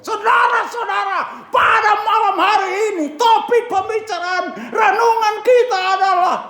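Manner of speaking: sudden restored-voice shout to the crowd; preaching shout. Retold or imitated. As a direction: boom it out from the pulpit. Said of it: "Langsung saya teriak".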